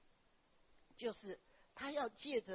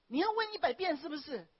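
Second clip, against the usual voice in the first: loud scolding shout, retold; angry coach; 6 semitones higher, 10 dB louder than usual. Driving coach yells at student asking twice, loud scolding shout. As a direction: yell it like an irritated driving coach. Coach snaps at learner driver.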